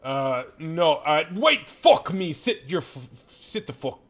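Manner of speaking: angry outburst. Acted. Keeper losing patience with the party, angry. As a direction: blow up then order them down. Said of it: "he goes".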